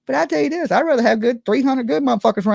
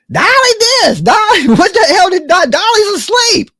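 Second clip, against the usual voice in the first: loud, higher, frantic mock complaining about Dolly; mock outraged ranting; 8 semitones higher, 8 dB louder than usual. Vlogger imitating haters yelling about her while asleep, loud mock rant. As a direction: yell it like haters piling on. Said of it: "it's like".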